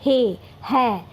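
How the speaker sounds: हे short and closed, है open and stretched out slowly; हे versus है. Shown as a demonstration. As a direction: draw out हे then है to show the vowel change. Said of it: "हे और है में difference आपको पता चलना चाहिए". (हे और है को धीरे और अलग-अलग बोलिए)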